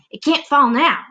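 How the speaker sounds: high, loud shout; Aunt Sponge shouting. Acted. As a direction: shout in relief. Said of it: "Aunt Sponge shouted".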